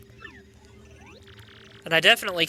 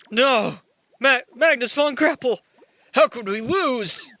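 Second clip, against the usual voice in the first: raised, dragged-out cry of defeat; dismayed villain; 5 semitones higher than usual. Acted. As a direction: wail in dismay as the beaten villain. Voices defeated game villain.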